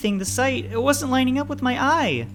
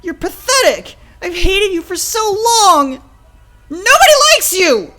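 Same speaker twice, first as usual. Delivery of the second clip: high, loud, hateful tirade; furious screaming; 15 dB louder, slower than usual. Acted. Child unleashing long-held hatred at father, screaming. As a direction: hurl insults at the top of your voice.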